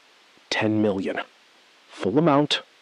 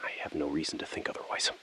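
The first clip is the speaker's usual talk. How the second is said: fast, barely voiced reply; hushed assurance to the hostage taker; 4 semitones lower, 11 dB quieter, faster than usual. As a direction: murmur it quickly under the breath. Tense phone negotiation.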